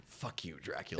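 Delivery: low, soft vampire growl; vampire snarl. Acted. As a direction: snarl it at Dracula. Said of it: "I'll do a transformation and then I'll go".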